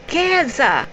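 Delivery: high-pitched eager exclamation; sudden high burst of recognition; excited outburst. A narrator's reading. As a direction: cry it out as if jumping up. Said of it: "Matu nearly jumped".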